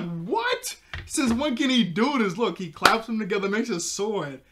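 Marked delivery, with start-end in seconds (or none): 0.0-2.4 high, loud stunned disbelief. Retold or imitated. Reacting to the cartoon fight, shocked high-pitched disbelief. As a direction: blurt it out like you can't believe your eyes. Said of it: "I'm like".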